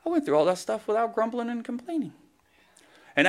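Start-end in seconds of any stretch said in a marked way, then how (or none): 0.0-2.1 high, wondering exclamation; preacher voicing his surprised relief at the end of the day, high; amazed at self. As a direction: marvel at your own patience. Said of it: "I'm like".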